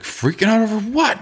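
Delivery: high-pitched, incredulous mock of Tommy; surprised question. Friend Tommy quoted, surprised.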